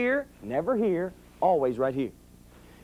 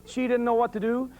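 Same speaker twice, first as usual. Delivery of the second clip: ordinary talk, nothing marked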